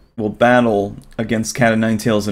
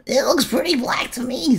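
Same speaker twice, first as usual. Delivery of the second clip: high, softer, timid little creature voice; nervous squeaky creature; 4 dB quieter than usual. Acted. Blue creature introduces itself to Bubble, high and timid.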